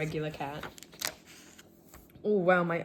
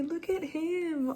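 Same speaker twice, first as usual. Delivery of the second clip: much higher, slow doting coo on "Look at him"; 11 semitones higher, slower than usual. Real, not acted. Fan showing viewers her drawing of Lester, slow high cooing baby talk. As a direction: coo it like a proud owner. Gushing over a favourite drawing.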